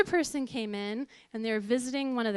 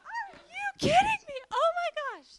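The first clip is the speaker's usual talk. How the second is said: much higher, squeaky outraged disbelief; exasperated disbelief. Retold or imitated. Wife reacting to the preacher's claim, much higher in outrage. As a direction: shriek it like you can't believe this.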